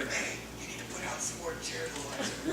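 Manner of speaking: mock hushed backstage whisper. God giving Elijah a chore, mock whisper. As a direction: whisper an errand as if in secret. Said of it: "God isn't whispering".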